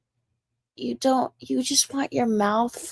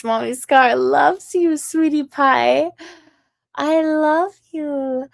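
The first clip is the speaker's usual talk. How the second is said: higher, slow, louder mock-sweet cooing to a child; 5 semitones higher, 5 dB louder, slower than usual. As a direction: coo it sarcastically, higher and slow. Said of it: "I'm being really nice".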